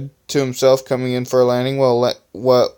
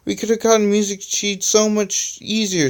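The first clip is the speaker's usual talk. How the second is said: high-pitched fussy complaint; mild fit; 9 semitones higher than usual. A narrator's reading. Stunter complains about the sheet music, getting into a fit. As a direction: whine it up high, mildly worked up. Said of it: "getting into a fit".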